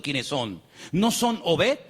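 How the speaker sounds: ordinary delivery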